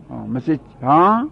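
ordinary delivery

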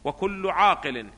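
slow reading with long drawn-out vowels; textbook recitation. Acted. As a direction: recite the text slowly, almost chanting. Reads the textbook aloud. (قراءة بطيئة ممدودة للمتن)

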